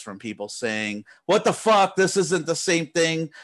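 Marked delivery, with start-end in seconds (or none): 1.3-3.3 mock outraged customer, higher and louder; imitating an irate customer's complaint; angry customer. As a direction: read out the angry email like a fed-up buyer. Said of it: "angry fucking, uh, emails".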